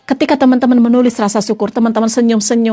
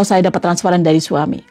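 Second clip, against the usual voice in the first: grateful prayer; 8 semitones lower than usual. Shown as a demonstration. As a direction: murmur it humbly to God. Alternative version of the prayer.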